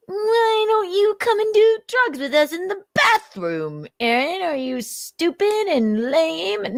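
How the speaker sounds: high, sneering mock of a druggie friend; mocking stoner voice. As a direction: taunt him like a mocking party kid. Mocking quote of the group.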